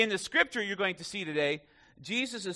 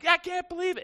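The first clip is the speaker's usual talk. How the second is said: mock crying lady; 11 semitones higher, 3 dB louder than usual. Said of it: "she's, like, crying".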